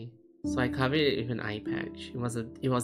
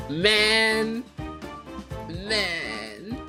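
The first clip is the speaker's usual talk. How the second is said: groaning; 9 semitones higher, 5 dB louder than usual. Real, not acted. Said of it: "I forgot the story is so dark".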